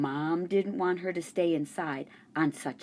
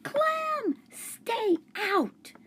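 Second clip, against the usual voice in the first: put-on high girl's shout at the dog; a girl shouts at her dog to stay out; much slower than usual. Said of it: "Miranda shouted".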